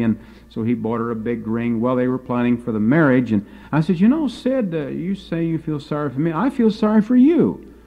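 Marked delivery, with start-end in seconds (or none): 4.0-7.7 high, teasing mock pity; teasing retort. Narrator teasing engaged friend, high mock pity. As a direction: turn the pity back on him playfully. Narrator turning it back on Sid.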